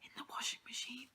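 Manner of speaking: sneaky whisper, plotting the hiding spot; whispered planning between friends. Real, not acted. Hiding a book, S0 nearby.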